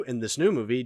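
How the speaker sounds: ordinary talk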